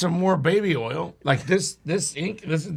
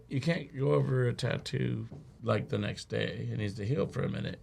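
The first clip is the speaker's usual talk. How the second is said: meek objection; 5 semitones lower, 11 dB quieter than usual. Retold or imitated. Timid objection to the tattooist.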